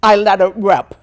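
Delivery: loud, full-voiced burst; letting it rip. Real, not acted. Deaf speaker showing off hard-won voice, loud. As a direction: belt it out with the voice now owned. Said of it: "now that I have the voice".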